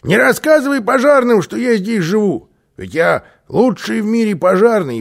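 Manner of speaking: high, loud, urgent instruction; lively, high-pitched boastful holler; calling out. A narrator's reading. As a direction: shout a parting warning across the roof. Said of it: "крикнул Карлсон".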